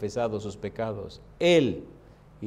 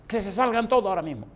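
orden airada; 7 semitones higher, 3 dB louder, faster than usual. Retold or imitated. Enfermo echa a su familia.

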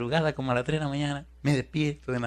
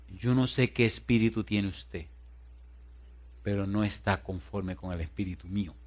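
solemn challenger; 4 semitones lower, 6 dB quieter than usual. Retold or imitated. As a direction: lightly voice the stern mystic. Imita al tipo desafiante. (imitando lento y grave al místico)

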